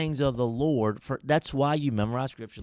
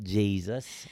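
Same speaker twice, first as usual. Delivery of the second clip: put-on Southern accent; 2 semitones lower than usual. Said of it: "Did y'all hear that?".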